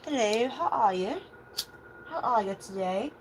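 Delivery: put-on lilting Australian-Cali mixed accent, rising at the ends; strong accent. Psychic greets viewers in an accent picked up in a reading, strong Australian-Cali accent. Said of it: "Somebody has a very strong accent".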